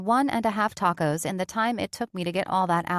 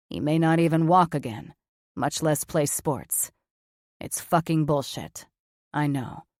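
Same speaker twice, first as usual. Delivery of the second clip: a lower man's voice, challenging; lower, blunt Cameron; 4 semitones lower than usual. A narrator's reading. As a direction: push back lower, like a grown man who can take it.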